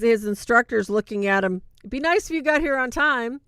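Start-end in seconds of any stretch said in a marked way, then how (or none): 1.8-3.4 higher, louder, a teacher scolding a latecomer; dry reproach to a student who's late; stern instructor. Retold or imitated.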